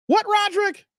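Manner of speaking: high, startled what aimed at Roderick; surprised outburst. Real, not acted. Streamer caught off guard by donor's joke, high startled cry. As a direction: jump up high in disbelief.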